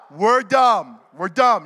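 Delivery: loud, punchy chant to lead a crowd; big voice, leading the room. Shown as a demonstration. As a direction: belt it out for them to repeat. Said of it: "say it loud".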